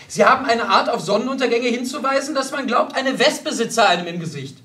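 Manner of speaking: loud, high tirade; indignant. A narrator's reading. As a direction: scold her companion in outrage. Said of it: "rief die Generalin entrüstet".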